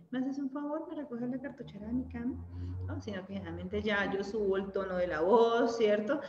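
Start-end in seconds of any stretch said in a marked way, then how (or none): 0.1-2.3 polite request. Acted. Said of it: "no te llamo y te digo".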